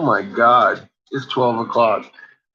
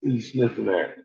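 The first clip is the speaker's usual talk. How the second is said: ordinary talk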